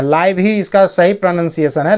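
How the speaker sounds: ordinary delivery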